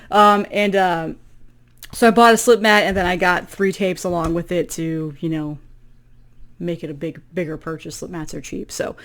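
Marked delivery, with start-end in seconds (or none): none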